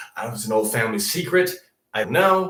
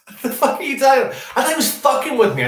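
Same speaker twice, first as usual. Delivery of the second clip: high, fast, louder laughing outburst; half-laughing shout of disbelief; 12 semitones higher, 6 dB louder, much faster than usual. Retold or imitated.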